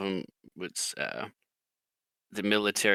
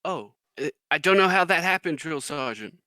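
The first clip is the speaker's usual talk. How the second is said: lightly voiced clueless recruit, quick and higher; recruit private; 7 semitones higher than usual. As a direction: imitate a private pleading ignorance to his sergeant. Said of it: "you hear the private".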